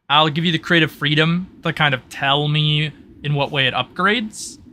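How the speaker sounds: ordinary delivery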